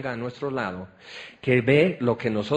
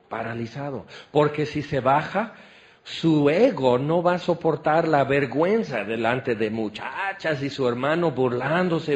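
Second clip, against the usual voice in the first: usual speaking voice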